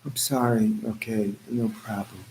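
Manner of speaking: low, soft unbothered apology; calm. Acted. Calmly answering an angry driver on the highway. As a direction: let it go softly, pitch dropped. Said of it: "We gotta be calm".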